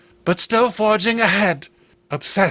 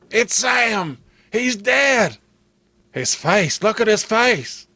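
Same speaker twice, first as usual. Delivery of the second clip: higher, horrified cries; grief-struck brother; 5 semitones higher than usual. Acted. Clem finding his dead brother, horrified. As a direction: reel back in horror at the body.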